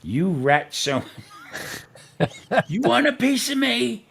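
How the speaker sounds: hammed-up challenge, rising high with laughter breaking in; mock tough-guy yell; retold angry call-out. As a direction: replay picking a fight, half laughing. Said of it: "I called him out".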